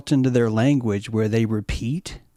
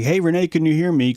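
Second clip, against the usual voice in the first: louder, higher, quick radio call; walkie-talkie call; 6 semitones higher, 4 dB louder, faster than usual. Retold or imitated. To Renee on a walkie-talkie, quick radio call.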